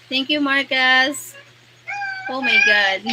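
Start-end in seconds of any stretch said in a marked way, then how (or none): 2.3-3.1 louder cry of joy then high squealing laugh; overjoyed squeal. Real, not acted. Streamer thanking a viewer for a gift, squealing with joy. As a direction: burst out in delighted disbelief.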